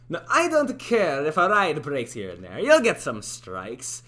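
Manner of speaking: loud boss voice, slowing on the strikes; accented boss character. Acted. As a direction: project the rule in character. Boss line tried once more.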